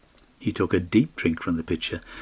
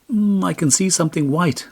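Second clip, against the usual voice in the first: higher, uncertain squint of a voice; straining to see; 10 semitones higher, 3 dB louder than usual. A narrator's reading. Askeladd squinting into the distance, higher and unsure. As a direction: peer far off as you say it. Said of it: "straining his eyes".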